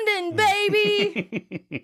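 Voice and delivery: high, drawn-out cheer; excited catchphrase. Retold or imitated. Retelling Joey's famous catchphrase, excited. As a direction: stretch it out in a mock whoop. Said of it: "says his second famous catchphrase".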